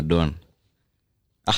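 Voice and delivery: usual speaking voice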